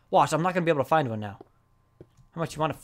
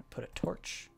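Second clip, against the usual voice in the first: softly whispered aside; hushed note to self; 15 dB quieter than usual. Real, not acted. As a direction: whisper it to yourself. Sneaking quietly in game.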